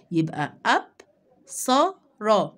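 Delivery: اب then ص then ر split apart with pauses; syllable by syllable. Shown as a demonstration. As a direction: say اب، ص، ر as separate sound chunks. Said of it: "بقرا المقطع ده مع بعض".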